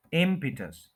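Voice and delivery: Impetus as IM-pi-tus; impetus falling away from a stretched IM; stress on first syllable. Shown as a demonstration. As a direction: lean on IM in impetus, short pi. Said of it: "इम पे stress करना है".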